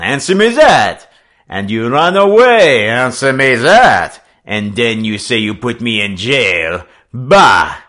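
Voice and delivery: put-on loud, high French-accented bullying; shrill, wrathful. A narrator's reading. French skipper bullying a captive boy, shrill and wrathful. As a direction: fire angry accusations at him, voice rising on each. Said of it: "His shrill voice rose wrathfully".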